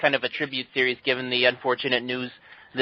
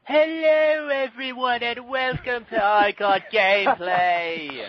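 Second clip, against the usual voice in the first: hammed-up fake British host voice, higher and drawn out; Michael Burhan impression; 7 semitones higher, slower than usual. [name] mocking the absent host's show opening in a hammed-up British voice. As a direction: play the co-host as a pompous British showman, slowly. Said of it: "that was less Michael Burhan and more my Bob Hoskins".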